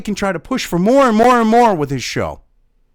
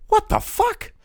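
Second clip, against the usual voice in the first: high, thin Kermit squawk, much higher; a shocked puppet frog blurting it out; 14 semitones higher, 4 dB quieter, slower than usual. Retold or imitated. Fan imagining Kermit reacting to the news, high frog voice. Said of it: "listening to Kermit the Frog going".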